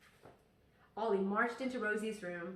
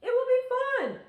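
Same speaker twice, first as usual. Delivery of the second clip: much higher and louder, bursting with fun; excited teacher; 12 dB louder than usual. Acted. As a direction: sell the fun like a teacher who can't wait. Teacher encouraging class.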